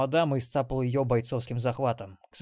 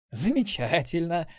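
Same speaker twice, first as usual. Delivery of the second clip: slow, raised-pitch relish; gloating grin; 5 semitones higher, slower than usual. A narrator's reading. Xenobyte strapping down his prisoner, slow gloating. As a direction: draw it out with a wicked grin. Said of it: "оскалился Ксенобайт".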